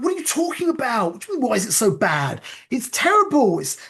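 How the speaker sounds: high-pitched, heated retold protest; indignant retort. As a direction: snap back in mock outrage at the very question. Retold outrage at inner voice.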